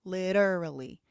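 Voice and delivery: LITERALLY with the T reduced to a D; flapped T. Shown as a demonstration. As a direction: demonstrate LITERALLY as "lidderally". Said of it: "reduce the t sound to a d sound".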